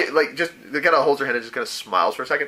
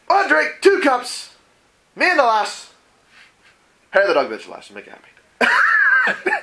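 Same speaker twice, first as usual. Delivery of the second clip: put-on high, slow slurred drunk ending in a shout; drunken rambling; 12 semitones higher, 4 dB louder, slower than usual. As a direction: slur the order like a happy drunk, then holler. Mara's like.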